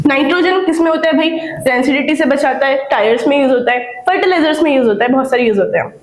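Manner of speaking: ordinary talk, nothing marked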